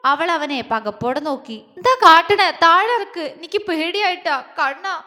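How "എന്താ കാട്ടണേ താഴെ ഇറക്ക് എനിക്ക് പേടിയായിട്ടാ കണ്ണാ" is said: higher, louder frightened cry; struggling in fright. Acted. Jwala, lifted by Agni, frightened cry to be put down. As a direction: squirm and yell to be put down. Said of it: "അവൾ പിടഞ്ഞോണ്ട് പറഞ്ഞു".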